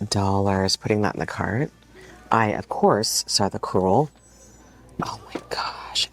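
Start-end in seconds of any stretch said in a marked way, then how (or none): 5.0-6.1 hushed, voiceless oh my gosh; awed whisper. Real, not acted. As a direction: whisper it like a secret find. Spots crewel piece.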